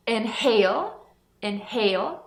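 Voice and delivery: inhale with a clear h between in and hale; h sounded. Shown as a demonstration. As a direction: breathe the h in the middle. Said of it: "H aqui pronunciado".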